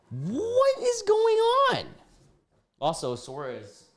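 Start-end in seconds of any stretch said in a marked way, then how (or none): 0.1-1.9 very high, slow squeal of disbelief; incredulous squeal. Real, not acted. Streamer baffled by creepy texts, slow high squeal.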